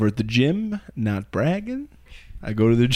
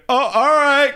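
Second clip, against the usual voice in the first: taken aback; 11 semitones higher, 3 dB louder than usual. Retold or imitated. Trainer taken aback by outburst.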